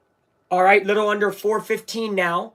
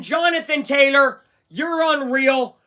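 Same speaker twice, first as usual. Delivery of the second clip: usual speaking voice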